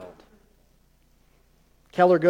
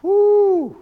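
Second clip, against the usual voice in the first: high loud whoop; whoo exclamation; 13 semitones higher, 6 dB louder than usual. Real, not acted. Preacher whooping at his own intensity, high excited whoop. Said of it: "preacher reacting to his own outburst".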